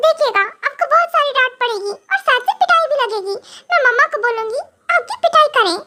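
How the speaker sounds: put-on high, whiny girl threatening to tattle; bratty tattletale voice; threatening childish wife. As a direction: threaten to run crying to mummy. Wife threatens to cry for help.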